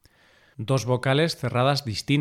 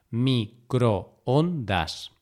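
microondas broken slowly into mi, cro, on, das; syllable by syllable; much slower than usual. Shown as a demonstration. Teacher dividing microondas to show the hiato, syllable by syllable. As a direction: say "microondas" as four separate syllables.

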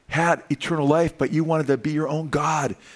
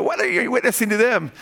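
high imitation of a questioning kid; little boy's scratchy voice; 9 semitones higher than usual. Preacher imitates little Justice questioning, high scratchy child voice. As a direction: ask it in a put-on child voice. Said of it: "in his little scratchy voice".

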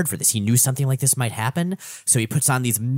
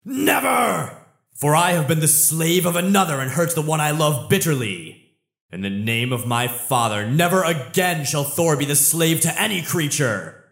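Thor's defiant vow; 5 semitones higher than usual. Acted. Thor refusing to surrender to Hela, booming heroic defiance. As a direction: proclaim it like a god refusing to kneel. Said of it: "but he fights back".

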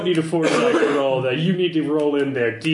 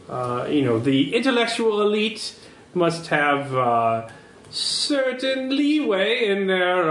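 higher-pitched, halting highbrow euphemism; affected intellectual snob; 5 semitones higher than usual. Acted. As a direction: pick each delicate word with lofty care. Contact explains clients' tastes evasively.

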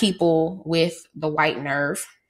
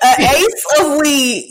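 higher, louder teasing repeat of "ace"; amused echo; 7 semitones higher, 5 dB louder than usual. Real, not acted. Host teasing her cohost's wrong slang, amused echo.

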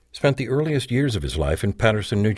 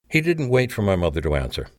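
usual speaking voice

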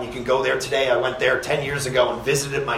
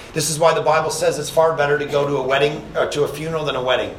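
ordinary delivery

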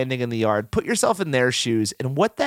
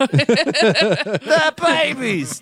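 high, drawn-out Cockney cry; very slow, exaggerated Cockney accent; Michael Caine; 12 semitones higher, 4 dB louder, much slower than usual. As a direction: holler it in hammed-up Cockney. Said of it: "It's Michael Caine".

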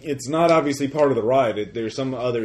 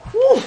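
very high, loud whoo; whooshing whoop; 9 dB louder than usual. Acted. Game master at the first descent, high whoop. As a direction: whoop as the ride drops.

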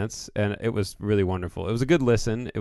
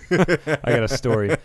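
laughing; 6 semitones higher, 7 dB louder, slower than usual. Retold or imitated. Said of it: "And I was like".

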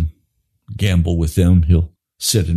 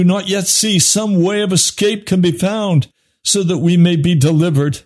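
higher, driving sermon voice; fiery preaching; 10 semitones higher than usual. Acted. Preacher warning his congregation, fiery high preaching. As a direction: preach it hard, building to the altar call.

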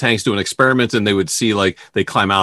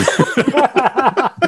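high burst of laughter; 13 semitones higher than usual. Real, not acted. Cuttle bone joke.

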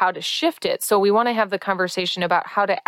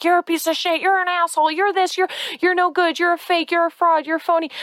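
high, sneering mock insults; hammed-up berating stranger; insulting jerk at the door; 10 semitones higher, faster than usual.

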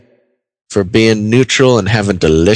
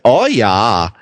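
badly faked Swiss accent that sounds Minnesotan. Host mimics someone from Switzerland, botched Swiss accent sounding Minnesotan. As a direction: attempt Swiss, land in Minnesota. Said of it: "sounds from Minnesota".

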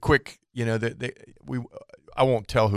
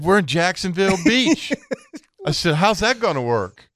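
disbelief; 7 semitones higher than usual. Retold or imitated. Customer mocks the Denver answer.

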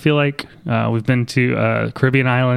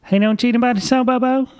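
high, fast small-town cashier voice; chirpy Midwest clerk impression; Wisconsin accent; 11 semitones higher than usual. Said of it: "and she's like".

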